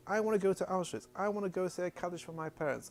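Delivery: high, insistent imitation of an upset mother; retold agitation. Mother demanding to say Kaddish for parents, agitated retold insistence. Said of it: "she was getting very agitated".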